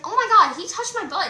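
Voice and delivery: high, loud burst on the opening; retold excited gossip. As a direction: re-enact spilling scandalous news.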